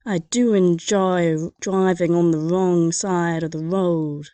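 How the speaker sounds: slow, hammed-up posh British; American doing a bad British accent; American faking a British accent for the examiner; mock British accent. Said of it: "do your best impression of a Brit".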